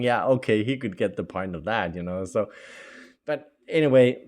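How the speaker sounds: normal talking voice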